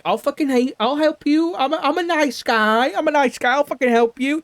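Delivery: high, mock-sweet nice-guy voice; fake nice guy. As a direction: ham up a phony friendly offer. Mocking gang's fake friendly pitch.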